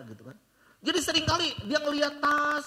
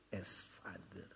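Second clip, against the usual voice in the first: whisper; 15 dB quieter, much slower than usual. Acted. Whispered line of sermon.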